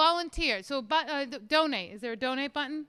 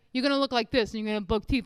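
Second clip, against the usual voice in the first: usual speaking voice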